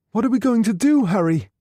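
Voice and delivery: anxious Helen. Acted. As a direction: beg Harry for a plan.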